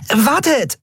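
high call to those leaving; calling after. A narrator's reading. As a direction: call out to make them wait. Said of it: "rief Nelen".

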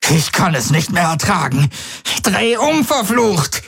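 very high, frantic cry rising to a shriek; panicked. A narrator's reading. Jun begs Galen to turn back, frantic and panicked. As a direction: lose control completely in panic. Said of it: "Sein Blick flackerte vor Panik".

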